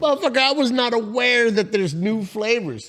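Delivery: high mock-shocked; mock outrage. Reacting to news of new flavors, high mock shock.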